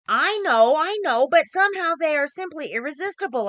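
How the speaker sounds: gleeful. A narrator's reading. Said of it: "I said".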